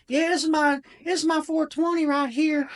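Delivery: put-on very high Southern child voice; little tractor kid. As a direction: show off a tractor as a proud eight-year-old. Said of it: "he's, like, this little kid".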